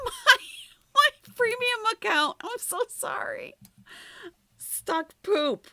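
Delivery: high embarrassed laughter; laughing. Real, not acted.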